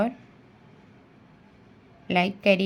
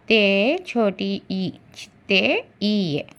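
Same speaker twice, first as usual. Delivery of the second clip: slow spelling out; much slower than usual. Shown as a demonstration. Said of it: "छोटी ए के साथ मिलाएंगे तो क्या आया".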